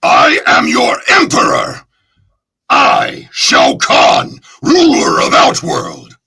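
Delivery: loud, slow, rasping emperor's roar; thundering tyrant's decree; Shao Kahn proclamation. Shown as a demonstration. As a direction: proclaim your rule over the conquered. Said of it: "another one that people really love".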